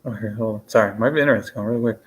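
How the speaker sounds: ordinary talk, nothing marked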